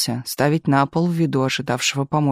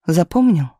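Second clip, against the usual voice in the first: insistent question; 4 dB louder than usual. A narrator's reading. Galina checks that Tumanov will remember, insistent. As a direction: press him for confirmation.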